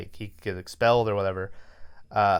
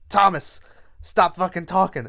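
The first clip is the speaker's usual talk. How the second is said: higher-pitched mock telling-off; mock teacher scolding; 6 semitones higher than usual. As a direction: scold the kid as a retold teacher. Teacher snaps in retold story.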